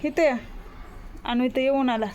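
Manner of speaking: ordinary delivery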